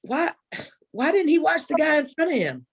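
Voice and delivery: high, exasperated mom voice; a lightly voiced mama scolding the TV; mimicking mama. Mama yelling at the contestant on TV, high. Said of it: "She's like".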